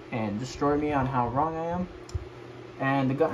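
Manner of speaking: normal talking voice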